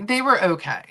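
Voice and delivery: ordinary talk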